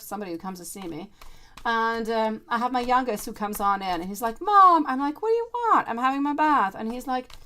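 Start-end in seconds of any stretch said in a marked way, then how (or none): 4.4-4.8 put-on high, loud child call from the doorway; son calling mom. 5.2-6.7 annoyed mom. Retold or imitated. Mom annoyed at interrupting son.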